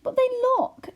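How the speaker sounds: high, loud alarmed cry; exclaiming. A narrator's reading. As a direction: blurt it out in fright. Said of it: "exclaimed Homily".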